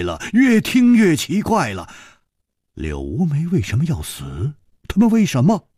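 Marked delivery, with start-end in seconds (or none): none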